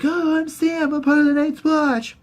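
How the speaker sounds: high-pitched dopey-friend impression; Sam. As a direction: introduce yourself in a put-on high voice. Impression of Sam introducing himself.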